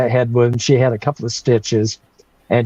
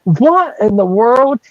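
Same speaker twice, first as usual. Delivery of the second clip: shocked mom; 8 semitones higher, slower than usual. Retold or imitated. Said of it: "my mom looks at me and goes".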